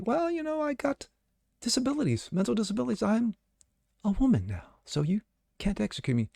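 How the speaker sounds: a sneering falsetto pretending to be helpless; mocking whiny excuse. Host parodying the killer's excuse in a whiny high voice. Mock quote of the convict's excuse.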